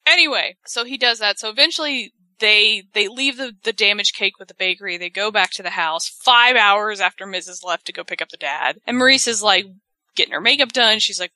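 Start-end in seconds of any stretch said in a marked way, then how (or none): none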